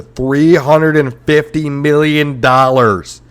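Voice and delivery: loud, high, drawn-out figure; incredulous outrage. Real, not acted. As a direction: boom the price out slowly. Outraged at soaring cost.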